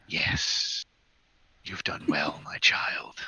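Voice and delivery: raspy, whispered voice from the flames; raspy fire voice. Acted. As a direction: breathe it out like a voice in the fire. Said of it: "you swear you hear a raspy voice".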